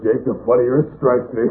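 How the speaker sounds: normal talking voice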